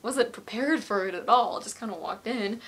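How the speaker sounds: ordinary delivery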